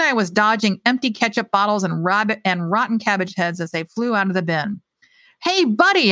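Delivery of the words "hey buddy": high, louder call to the man; high, raised shout to the homeless man; anxious call. Acted. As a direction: call out anxiously across the dumpster. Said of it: "I called anxiously".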